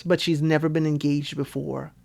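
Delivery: normal talking voice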